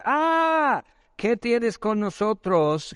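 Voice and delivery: high wailing cry easing into words; long high "ah", then a slow plea; acted agony. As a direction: imitate a tormented demon crying out. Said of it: "están en agonía, es dolor".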